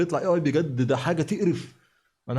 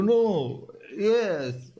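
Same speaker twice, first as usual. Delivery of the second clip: mock English replies, higher and drawn out; imitating clueless English; 7 semitones higher, much slower than usual. Host mocking the old man's English, clueless imitation. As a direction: lightly mimic someone who only knows no and yes. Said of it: "هو تلاقي الراجل بيشتمه وهو بيقول له".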